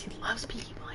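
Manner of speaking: soft unvoiced whisper aside. Real, not acted. Whispering to viewers about her dad. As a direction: breathe it as a secret.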